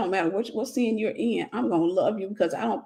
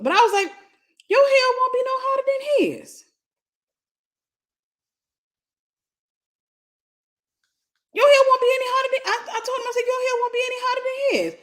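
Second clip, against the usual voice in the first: strained falsetto telling; high put-on voice; 12 semitones higher than usual. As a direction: keep the squeaky high voice going.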